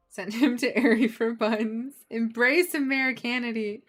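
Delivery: loud, laughing proclamation; laughing declaration. Real, not acted. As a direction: announce it grandly through laughter.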